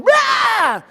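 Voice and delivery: high, loud mock roar; imitating angry child. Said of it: "your six or seven-year-old is going".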